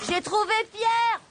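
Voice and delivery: high, slow triumphant call; excited call. Acted. Angelo to the family, excited call. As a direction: shout the good news. Found lost little brother.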